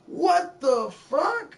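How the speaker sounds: high, loud, drawn-out what the fuck; shocked outburst. Retold or imitated. Retelling the slavery discovery, a loud shocked outburst. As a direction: blurt it out in stunned disbelief.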